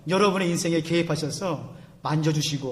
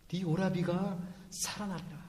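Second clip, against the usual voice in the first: softer voiced take with 오라비가 leaned on, falling end; soft spoken promise; 11 dB quieter than usual. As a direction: say it gently aloud to Martha. Jesus voiced comforting Martha.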